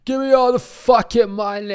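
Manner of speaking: high, shouted robber voice; robber yelling. Retold or imitated. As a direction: yell like a robber at gunpoint. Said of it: "pulls out a gun".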